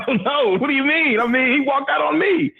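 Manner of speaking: high-pitched mock panic; retold alarmed protest. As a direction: plead innocence, voice climbing high. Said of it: "I'm like".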